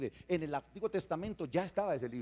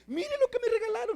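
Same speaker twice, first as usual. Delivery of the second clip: put-on very high, louder, laughing child voice; child showing a parent a new present; excited child; 6 dB louder than usual. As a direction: gush like a kid showing a gift. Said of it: "un hijo suyo viene y le dice".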